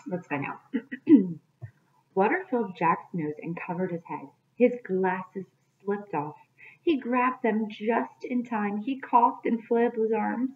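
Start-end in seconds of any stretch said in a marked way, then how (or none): 2.1-10.5 put-on expressive read-aloud for kids; storybook narration. A narrator's reading. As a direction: read the chapter like a teacher at storytime. Reads chapter to children.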